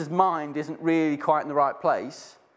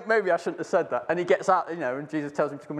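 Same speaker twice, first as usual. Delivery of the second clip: usual speaking voice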